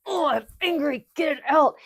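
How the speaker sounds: high, loud imitation of a kid venting; mock angry yell. Therapist imitating kids getting anger out, mock furious yell. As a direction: let it burst out like an angry child.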